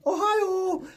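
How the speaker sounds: very high, drawn-out "Ohio"; mimicked "Ohio" call. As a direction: mimic someone singing out "Ohio" on walking in. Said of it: "just walked in and gone".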